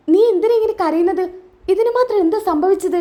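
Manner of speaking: high-pitched, mildly alarmed questions; acted worried surprise; concerned questioning. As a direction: press for the reason. Acted mother-in-law questions crying Varsha.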